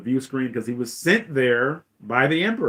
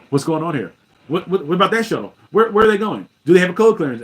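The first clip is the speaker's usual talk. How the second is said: higher, louder stammered demands; hasty, agitated questioning; 6 semitones higher, 8 dB louder than usual. Retold or imitated. Vader demands answers about the shuttle, agitated and loud. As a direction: fire questions impatiently, hammed-up.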